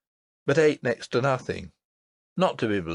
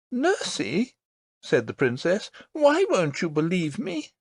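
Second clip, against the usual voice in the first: put-on high, earnest child's voice; a small girl insisting, wide-eyed; little princess voice; 7 semitones higher than usual. A narrator's reading. Princess tells her nurse about the lady, earnest.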